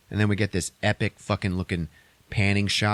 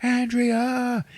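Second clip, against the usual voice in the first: high, drawn-out call for Andrea; hammed-up searching cry; mock screaming. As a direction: imitate a desperate hero calling her name. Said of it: "he's screaming for Andrea".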